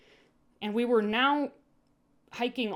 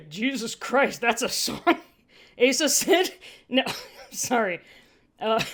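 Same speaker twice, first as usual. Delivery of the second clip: laughing through the words; breaking up with laughter mid-read; 3 semitones higher, 5 dB louder than usual. Real, not acted.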